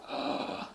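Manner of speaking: hushed, voiceless gasp; shocked gasp. Retold or imitated. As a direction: imitate a stunned audience's sharp breath. Crowd gasp acted out.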